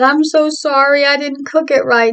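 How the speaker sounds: high, mildly upset apology; retold tearful apology. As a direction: apologize in a high voice, imitating tears. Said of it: "here I am still crying".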